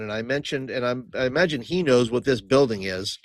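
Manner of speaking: ordinary talk, nothing marked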